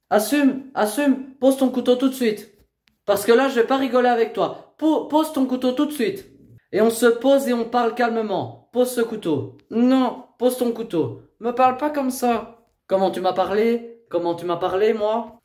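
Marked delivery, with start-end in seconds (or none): 0.1-11.2 raised, higher-pitched insistent order; stern command. Real, not acted. Educator ordering a young woman to drop the knife, stern. 11.4-12.6 high, heated retort; angry objection. Real, not acted. Young woman objecting to the educator's orders, angry.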